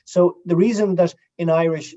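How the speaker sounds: usual speaking voice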